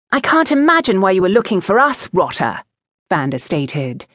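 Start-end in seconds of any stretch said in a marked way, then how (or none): 0.1-2.6 put-on lightly haughty young aristocrat; quick, higher-pitched lordly put-down; future duke. A narrator's reading. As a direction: put him in his place like a future duke. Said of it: "sounding all of a sudden like a future duke".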